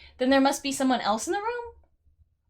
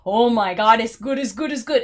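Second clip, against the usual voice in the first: louder, gushing burst of praise; mock rave; 3 semitones lower, 6 dB louder than usual. Player recalling reaction to the second game, excited. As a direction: rave over it in quick repeats. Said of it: "Two was like".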